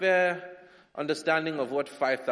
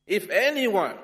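loud, higher call to the crowd; Jesus calls out; 6 semitones higher, 6 dB louder than usual. Acted. Jesus calling out loudly to the big crowd. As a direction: announce it to the whole crowd.

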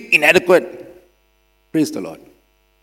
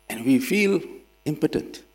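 usual speaking voice

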